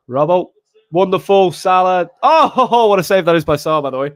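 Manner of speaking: loud burst of delight at a save; excited reaction. Real, not acted. Fan watching Salah's save by the way, excited.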